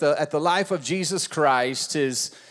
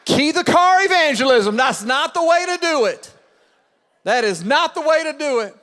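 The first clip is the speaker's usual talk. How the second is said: high, loud preaching; 9 semitones higher, 4 dB louder than usual. Acted. Pastor preaching the lesson to the church, full force. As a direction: drive the point home.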